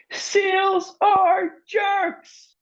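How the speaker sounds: very high, very slow, loud outburst; furious shout. Acted. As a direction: stretch every word in fury. Lorelei finally explodes at seals.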